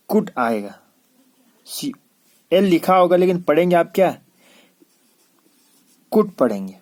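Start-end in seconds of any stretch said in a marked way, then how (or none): 0.1-0.3 कुड said with no L sound; short "could", L left silent. Shown as a demonstration. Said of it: "लेकिन एल को नहीं पढ़ना है". 6.1-6.3 कुड with the L left out; silent L. Shown as a demonstration. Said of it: "लेकिन एल को नहीं पढ़ना है".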